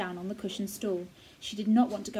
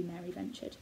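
ordinary delivery, nothing marked

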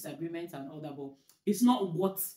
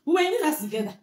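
higher, louder disbelief; incredulous; 10 semitones higher, 8 dB louder than usual. Real, not acted. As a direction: marvel at the long message. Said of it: "incredulous retelling".

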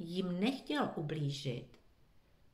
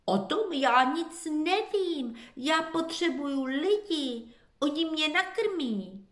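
higher, baffled question; puzzled; 7 semitones higher than usual. A narrator's reading. Confused dog answers the fox, higher. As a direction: ask as if you can't understand. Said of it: "nechápal Benny".

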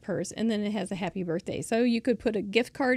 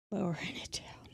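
whispered, concentrating; soft whisper to herself; 3 semitones lower, 10 dB quieter than usual. Real, not acted. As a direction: hush it as you work carefully. Whispers while carefully placing paper.

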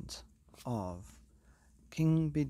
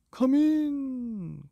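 high, loud bellowing king; booming king; 10 dB louder than usual. Acted. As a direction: boom it out as a jolly king. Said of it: "cried the king with a jig".

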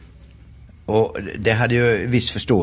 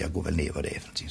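whisper; 4 semitones lower, 11 dB quieter, much faster than usual. Retold or imitated. Sneaking down at night, thinking.

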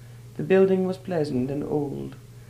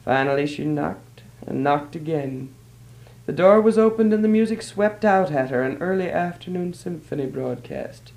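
plain reading voice

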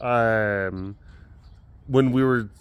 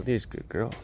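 soft cooing; 6 dB quieter than usual. Real, not acted. Praising the tiger in a soft voice. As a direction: coo gently to the cat.

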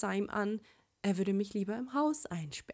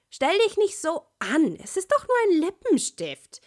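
put-on high, loud childish girl voice; a bratty kid brushing off his fuss, well above the narrator; little sister voice; 9 semitones higher, 8 dB louder than usual. Little sister teasing Sasuke about lipstick, high and loud.

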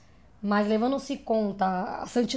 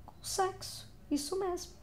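higher, much softer, confiding; soft, high near-whisper; hushed reveal; 9 semitones higher, 10 dB quieter than usual. Acted. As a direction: drop to a coy hush for the answer. Hushed answer to teasing question.

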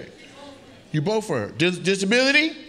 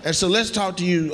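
ordinary talk, nothing marked

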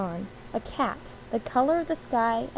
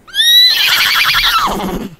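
very high, loud screech; long piercing screech; horse screech; 13 dB louder than usual. A narrator's reading. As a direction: let out a wild horse screech. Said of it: "screeched the horse".

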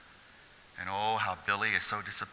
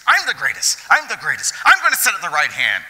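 louder, higher hammed-up bragging; mock boasting disciples; 8 semitones higher, 10 dB louder than usual. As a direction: crow it like a pushy disciple. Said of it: "You got the disciples trying to".

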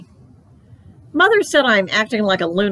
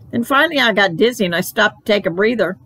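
plain reading voice

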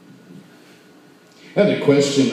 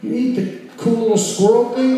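much higher outraged squeal; horrified kid; 12 semitones higher than usual. Retold or imitated. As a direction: squeal in childish shock. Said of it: "And I was like".

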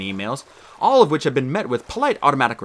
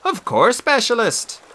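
Miles; 9 semitones higher, 4 dB louder, slower than usual. A narrator's reading. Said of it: "said Miles".

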